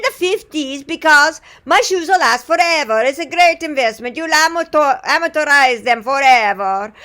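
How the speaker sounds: put-on loud, high, exuberant Italian accent; Pasquale Di Fabrizio, Italian accent. Italian shoemaker greeting a customer, boasting of his shoes, exuberant accent. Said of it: "he was from Italy".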